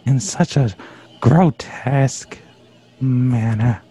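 low, halting outraged reproach; theatrical indignation. Acted. Fan rebuking co-host over wonderful lady, low indignant gravity. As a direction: pause for offended grandeur, pitched low.

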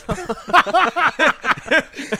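long, high laugh; real high-pitched laughing fit; laughter. Real, not acted. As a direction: crack up at your own joke. Laughs after his own punchline.

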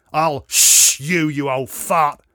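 loud, drawn-out threat; aggressive snarl. A narrator's reading. Lout threatening the father who shushed him, aggressive snarl. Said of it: "snarled the aggressive lout".

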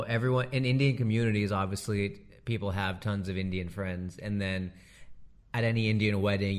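ordinary talk

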